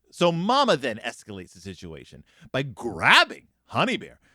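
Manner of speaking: normal talking voice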